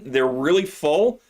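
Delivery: usual speaking voice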